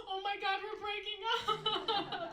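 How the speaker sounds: high gasping mock panic, slow on breaking up; panicked drama. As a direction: wail it like the relationship is over, hammed up.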